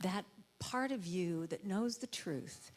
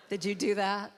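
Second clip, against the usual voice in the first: usual speaking voice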